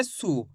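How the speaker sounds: सु soft and brief, falling; a quick, quiet सु with short u; short u sound. Shown as a demonstration. Teacher repeats the right matra sound, short soft सु. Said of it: "तो कैसे करेंगे".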